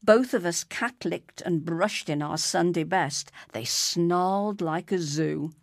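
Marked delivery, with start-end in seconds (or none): none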